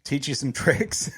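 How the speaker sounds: breaking into laughter mid-phrase; real laughing. Real, not acted. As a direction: try to finish the line but crack up. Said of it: "I couldn't say that without laughing".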